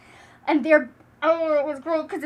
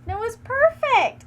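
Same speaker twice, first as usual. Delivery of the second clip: higher, slow and gushing; 8 semitones higher, 5 dB louder, slower than usual. Real, not acted. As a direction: savor the word perfect. Gushing about ending.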